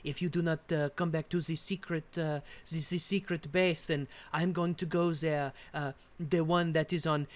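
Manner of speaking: put-on French accent. Worried French Resistance member phoning Pierre, mock French accent. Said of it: "this is how they talk".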